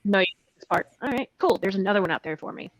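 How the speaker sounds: normal talking voice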